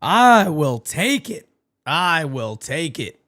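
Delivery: ordinary talk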